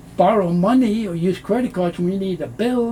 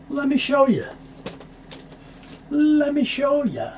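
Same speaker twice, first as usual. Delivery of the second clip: lively demo; 8 semitones higher than usual. Shown as a demonstration. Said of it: "this T sound isn't made".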